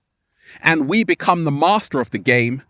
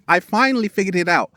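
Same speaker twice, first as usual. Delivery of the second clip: ordinary delivery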